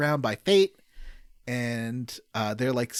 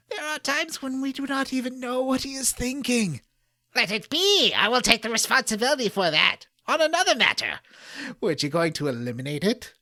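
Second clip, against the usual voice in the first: higher, theatrical cutscene voices, breaking into laughs; hammed-up game dialogue; 5 semitones higher than usual. Reading game script aloud to co-hosts, hammed-up dramatic voices. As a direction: overact the villains' lines while trying not to laugh.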